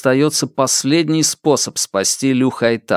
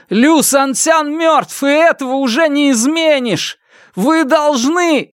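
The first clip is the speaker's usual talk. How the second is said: crying out. A narrator's reading. Фан Му appealing to the crowd, arms spread, crying out. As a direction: cry it out to the whole crowd. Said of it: "он выкрикнул".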